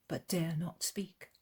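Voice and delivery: ordinary delivery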